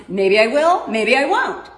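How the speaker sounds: imitating a teasing non-answer, rising then falling; mock flippant. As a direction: quote it with a sing-song shrug. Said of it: "such a flippant attitude".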